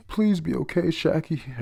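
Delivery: high-pitched, pleading read; mock tearful plea. Islanders all crying for Shaki, mock tearful plea. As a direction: lightly act a worried cry. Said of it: "Everyone's crying".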